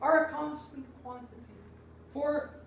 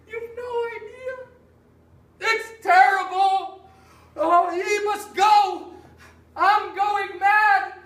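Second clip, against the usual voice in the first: loud, high, slow anguished cries; distraught shout; 6 semitones higher, 8 dB louder, slower than usual. Acted. Master cries out to the two men that he is going mad, slow anguished shouting. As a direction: wail out your despair about the servant.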